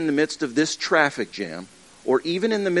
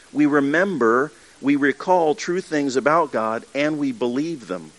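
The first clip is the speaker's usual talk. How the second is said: usual speaking voice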